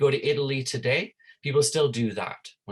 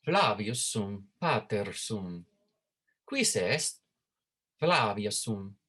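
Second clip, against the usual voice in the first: measured Latin read-aloud with deliberate intonation; demonstrative Latin reading. Shown as a demonstration. Teacher reads Latin dialogue for students to absorb its rhythm, measured demonstrative reading. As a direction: let the rhythm of each Latin phrase ring out. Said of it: "pay attention to how I intonate the, uh, the sounds".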